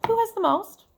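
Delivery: high, loud wondering question; curious question. Real, not acted. Teacher asking listeners who has most, high and curious. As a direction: ask it like a real puzzle. Reader asks class a question.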